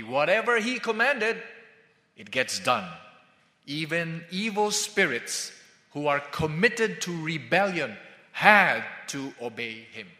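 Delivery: usual speaking voice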